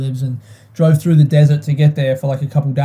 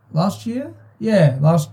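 ordinary talk

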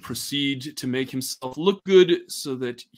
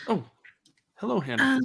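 flustered cook; 10 semitones higher, faster than usual. Acted. Elizabeth greets Hannah.

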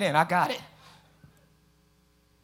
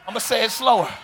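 normal talking voice

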